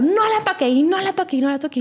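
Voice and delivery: imitating a nasty repeated warning; ugly scolding. Mother telling her not to touch the girl, rudely. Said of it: "así feo, feo, feo, feo".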